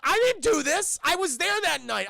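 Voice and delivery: high, loud acted denial; indignant protest. Accused Bruce protests to Alfred, high indignant outburst. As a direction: protest your innocence at the top of your range.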